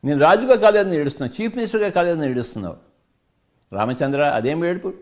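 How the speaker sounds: preaching at full force. Acted. Teacher scolding a listener who cries over nothing, fervent preaching. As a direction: hammer home what they already have.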